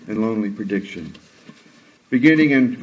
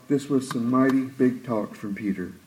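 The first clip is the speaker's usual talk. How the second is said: ordinary talk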